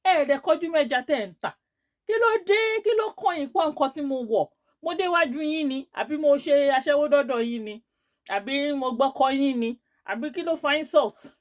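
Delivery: loud indignant questioning; retold anger. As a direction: tell someone off loudly, acting out the quarrel. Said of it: "That's how I got angry".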